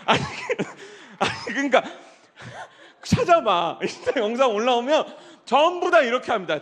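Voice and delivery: loud, laughing protest about his wife. Real, not acted. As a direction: laugh through the words. Laughing about his wife's dancing.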